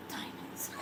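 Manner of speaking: Diamonds. breathed in a soft whisper. Shown as a demonstration. Repeats word, then whispers it.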